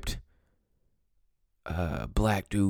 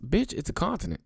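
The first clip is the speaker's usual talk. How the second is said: exasperated retort; 10 semitones higher, faster than usual. Real, not acted.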